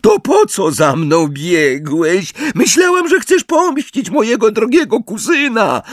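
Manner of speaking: put-on high-pitched babyish jeer; mocking baby voice. A narrator's reading. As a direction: taunt in a high childish voice. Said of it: "naśladując kpiąco głos dziecka".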